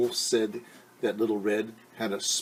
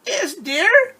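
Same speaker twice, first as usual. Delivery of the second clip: put-on high and loud scratchy old-lady voice; wolf pretending to be sick grandmother; wolf's fake granny voice; 9 dB louder than usual. Wolf in bed faking grandmother's voice to fool Red. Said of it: "came a scratchy voice".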